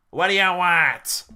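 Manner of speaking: loud, slow, hostile prison guard; gruff guard. Acted. Guard snapping at the visiting lawyer, loud and gruff. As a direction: bark it out like a surly guard.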